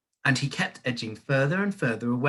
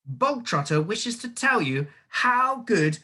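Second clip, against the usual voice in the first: much higher, louder calling out; shouted summons; 11 semitones higher, 5 dB louder, slower than usual. A narrator's reading. Headmistress summoning cook, high and loud. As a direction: call her in, mocking triumph. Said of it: "the Trunchbull shouted".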